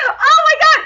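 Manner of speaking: loud, high fangirl squeal; giddy shriek of happiness; overjoyed. Real, not acted. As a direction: burst out with joy, high and loud. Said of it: "I was so happy".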